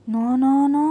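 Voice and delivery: loud shouted refusal; shouting no. A narrator's reading. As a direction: shout no at her in protest. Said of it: "అన్నాడు అరుస్తూ".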